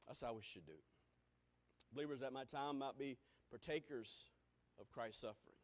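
ordinary talk, nothing marked

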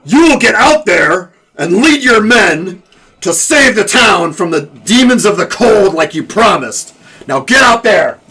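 booming slow command to a cowering lord; Nickel Cow voice. Acted. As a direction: drag him out by the collar and order him to lead. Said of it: "my Nickel Cow voice".

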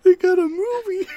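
high, loud squeak; helpless laughter. Real, not acted. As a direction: squeak it out while losing it laughing. Still cracking up.

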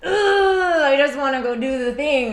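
high, loud groan of protest; retold exasperation. Wife retells her reaction to being told no, exasperated. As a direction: act out a frustrated first reaction. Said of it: "my first response is like".

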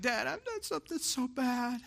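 high, soft, tearful daughter voice; small, high mock-crying plea; imitated weeping child. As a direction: imitate a child's weepy confession to dad. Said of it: "she's weeping and crying".

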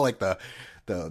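ordinary talk, nothing marked

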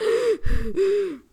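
one big high gasp for air, out of breath; hammed-up breathless gasp; winded gasp. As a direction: gasp like you just sprinted and can't get air. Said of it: "And then I'm like".

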